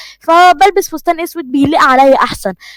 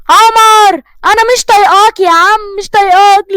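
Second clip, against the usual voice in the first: loud, high, fed-up yelling at him; angry outburst; 8 semitones higher, 5 dB louder than usual. Acted. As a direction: snap at him loud and high, at the end of her rope. فرح تنفجر في عمر. (صراخ عالي وغاضب)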